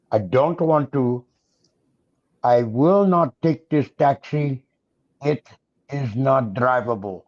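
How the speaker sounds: slow put-on Pakistani accent. Shown as a demonstration. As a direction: demo a Pakistani accent, slowly. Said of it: "how to do a Pakistani accent".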